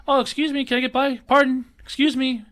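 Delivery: high, sugary overpolite pleading; mock politeness. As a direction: lay the politeness on thick, pitched high. Mock-polite squeezing past shoppers.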